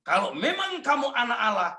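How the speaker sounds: high, sneering cut-off tempter line; devil's taunt. Acted. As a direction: say it like Satan mocking. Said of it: "begitu setan ngomong".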